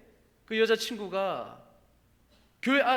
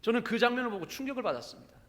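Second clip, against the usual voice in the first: normal talking voice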